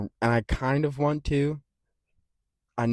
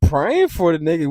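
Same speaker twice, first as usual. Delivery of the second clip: high, loud laughing talk; words laughed out, getting over the laugh; laughing while talking; 8 semitones higher, 8 dB louder than usual. Real, not acted. Laughing at own joke.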